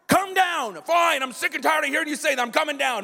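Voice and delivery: high, pestering call then exasperated giving in; fed-up surrender. Acted. Hostage taker worn down by kids' demands, exasperated. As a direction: cave in to relentless nagging.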